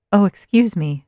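put-on thick American accent, bright and loud; lightly voiced American tourist girl. A narrator's reading. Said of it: "in her thick American accent".

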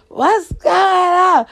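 high, loud, slow screaming mama from the window. Retold or imitated. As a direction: yell it out like mom at the window. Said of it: "She opened up her window screaming".

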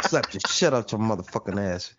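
deep, quiet mock menace; low mock threat. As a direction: growl the threat low and soft.

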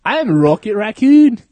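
put-on botched Scottish accent. Actor auditioning as the raccoon, botched Scottish accent. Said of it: "Are you in the fucking Beatles".

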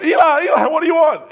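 high, loud repeated call; boy answering urgently. Acted. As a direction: play a roused boy calling out to the priest. Acts Samuel running to Eli again.

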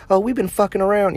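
lightly voiced cheeky mistress; fake girlfriend voice.

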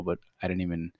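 ordinary talk, nothing marked

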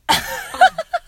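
high, hammed-up gasp; dramatic gasp. Girl acting out reading her breakup text, hammed-up shocked gasp. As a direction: gasp like a soap opera heroine. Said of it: "I dropped my phone all dramatic".

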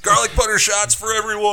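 high, sing-song announcement; hammed-up college partier; mock party cheer. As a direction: holler it like buying a round. Joining the college party bit.

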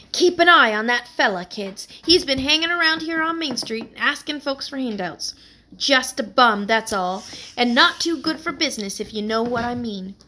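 lightly voiced New York warning; New York accent. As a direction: lean in and warn the kids in New York accent. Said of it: "same accent as Stella's".

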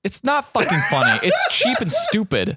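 worked-up, high-pitched protest; indignant rant with laughter near; real comic anger. Real, not acted. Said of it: "how angry this movie has made you".

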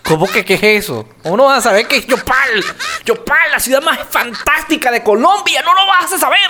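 hammed-up offended protest, climbing in pitch; mock indignation. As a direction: defend your hometown, rising in mock disbelief. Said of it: "Y yo".

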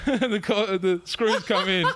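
high, slow words through laughter; laughing. Real, not acted. Storyteller describes guards arriving after the bashing, laughing. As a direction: keep telling it while cracking up.

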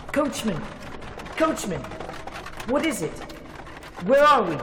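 high, loud cries over the rattle of the coach; alarmed shouting. Acted. Harker shouting up to the coachman, high and alarmed. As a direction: yell up to the driver in alarm. Frightened passenger shouting at driver.

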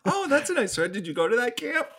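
mock-oblivious polite interest; clueless actress, imitated. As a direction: ask sweetly unaware, mimicking her. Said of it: "she wouldn't have a clue".

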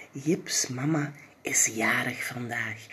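soft secret whisper. A narrator's reading. Narrator whispering the birthday secret to listeners. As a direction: let them in on a secret, hushed.

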